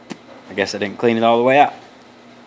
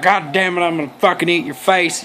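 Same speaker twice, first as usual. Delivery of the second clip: mock-irate outburst in a Texas accent, pitched up; hammed-up Texan indignation, raised pitch; 5 semitones higher than usual. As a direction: play an irate subject with a Texas twang. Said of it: "starts getting all irate".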